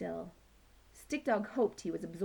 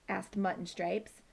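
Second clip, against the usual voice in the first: ordinary delivery, nothing marked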